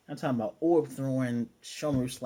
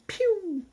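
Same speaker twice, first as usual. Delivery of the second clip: sound effect; 3 dB louder than usual. Retold or imitated. Character zips away, sound effect.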